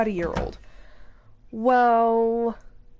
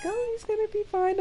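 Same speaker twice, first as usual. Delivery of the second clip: reassuring doctor; 11 semitones higher than usual. Retold or imitated. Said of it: "And the doctor told me".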